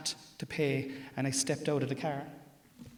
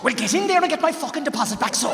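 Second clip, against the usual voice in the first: loud, higher angry demand; angry shout; 10 semitones higher, 9 dB louder than usual. Retold or imitated. Wife ordering husband to get the deposit, angry shout. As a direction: bark the order at him.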